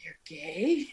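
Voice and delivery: hushed gasping whisper; teasing sister whispering in fake shock; mock shocked whisper.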